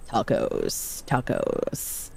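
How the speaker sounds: slow, low American accent with vocal fry. Shown as a demonstration. Streamer trying tacos in an American accent on request, fry-laden American accent. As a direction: put on a drawn-out American accent, softly creaking. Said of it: "I have to put, like, vocal fry".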